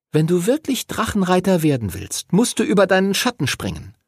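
put-on high, insistent girl's voice; girl urging. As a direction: urge them to stick together, high. Voices Lucy taking charge.

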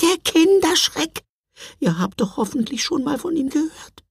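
very high, hushed creature voice; a squeaky little cookie warning; gingerbread man voice. Acted.

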